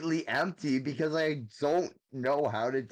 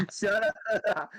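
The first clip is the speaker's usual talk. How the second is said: giggling protest; laughing through embarrassed retort; laughing shut up; 6 semitones higher than usual. Real, not acted.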